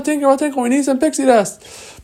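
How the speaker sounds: put-on very high, loud childlike call; urgent calling. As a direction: call out frantically for a missing fairy. Boys calling for Tinkerbell.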